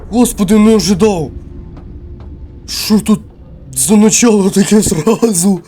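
loud, high startled cry of Ух ти; startled shout. Real, not acted. Player jolted by a sudden scare, shouting. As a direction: caught off guard and yelling it out.

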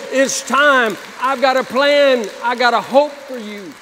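loud, fervent pulpit delivery building up; full-force preaching. Acted. Pastor calls the lost back home, loud and fervent. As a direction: preach it out with fire.

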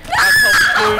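laughing shout. Real, not acted. Horde rushing at them.

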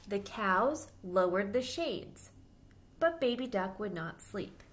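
put-on lively storybook voice for little kids; reading to children. A narrator's reading. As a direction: read it aloud like storytime for children. Reads picture book to children.

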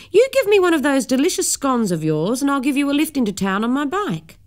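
put-on high, bouncy old gentleman voice; a cheerful cyclist making a playful deal; jolly Mister Murgatroyd. Mister Murgatroyd offers Missus Abercrombie a lift, high and jolly.

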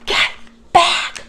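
forceful, breath-only stage whisper; urgent whisper. A narrator's reading. Jack warning Annie back from the dinosaur, urgent whisper. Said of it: "whispered Jack".

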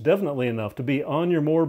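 ordinary delivery

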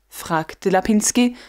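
ordinary delivery, nothing marked

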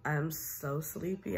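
normal talking voice

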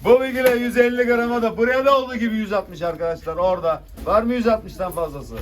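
loud auction call; auctioneer. Real, not acted. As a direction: call the lot to the room. Fish auction bidding call.

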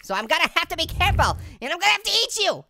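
normal talking voice

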